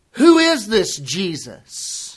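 slow, sneering "Who is this Jesus?"; derisive tone. Retold or imitated. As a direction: let the scorn drip. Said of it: "With a tone of derision in our voice".

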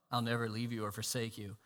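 quoting the promise in a hush. Retold or imitated. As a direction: say it softly, almost reverent. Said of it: "if you believe this promise".

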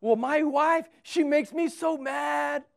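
mock angry shout, high and loud, last words drawn out; exasperated husband. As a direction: whine loudly like a fed-up husband. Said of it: "And they say".

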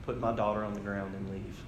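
hint of a movie father's quiet threat; low-key, softly spoken menace, lightly imitated; Liam Neeson. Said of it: "I remember what Liam Neeson says next".

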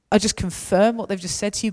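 usual speaking voice

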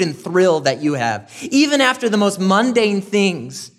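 high, loud, impassioned preaching; preaching at full force. Real, not acted. Preacher rousing the congregation, loud and high. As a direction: lift it high and ring it out like a revival preacher.

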